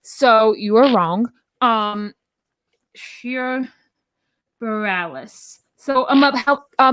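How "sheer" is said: quiet mocking "Sheer" with a long ee; Sheer pronunciation. Shown as a demonstration. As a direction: Sheer said softly, rhyming with beer. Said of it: "the name [name]".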